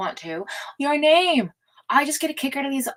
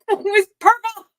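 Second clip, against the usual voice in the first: laughing through the words; 11 semitones higher, 8 dB louder than usual. Real, not acted. Gushing to chat about her antifreeze, laughing. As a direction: giggle it out, delighted.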